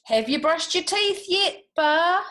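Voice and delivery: high, loud questioning voice; George asking. A narrator's reading. George checking on Bar's teeth, high and loud. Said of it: "said Bartholomew".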